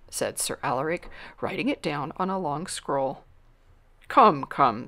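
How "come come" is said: brisk, loud impatient urging. Acted. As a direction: hurry them along, louder. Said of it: "said the king impatiently".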